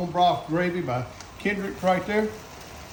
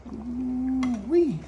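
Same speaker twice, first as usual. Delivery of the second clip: drawn-out, higher ooh-wee; high savoring exclamation; appreciative ooh-wee; 6 semitones higher than usual. Real, not acted. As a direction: let out a long "ooh-wee" of relish. Dishing up the stew.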